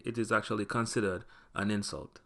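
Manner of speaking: normal talking voice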